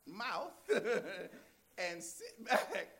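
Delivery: high, slow, laughing as he mimics her anger; hammed-up mother's rebuke through laughter; mock angry snap. Pastor retelling a mother snapping at her daughter, laughing.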